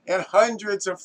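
usual speaking voice

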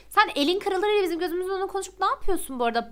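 fast, high, loud scolding; mock-angry rant at the game character. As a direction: tell him off at full speed.

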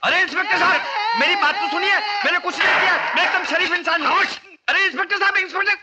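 high-pitched, urgent protest of innocence; desperate pleading. Acted. Accused man calls after the inspector, desperate high pleading.

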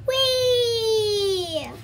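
high, loud joyful cry of "Whee!"; delighted squeal of fun; gleeful whee. Acted. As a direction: let it fly like rolling fast on skates. Character rolling on skates, joyful.

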